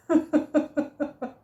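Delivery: a bright burst of laughter at herself; amused laugh. Real, not acted. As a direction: laugh out loud at your own costume. Laughing at her own look.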